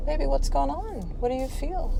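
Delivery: soft, high, tender questioning; gentle comforting parent. Retold or imitated. Mom to sniffling son, softly concerned.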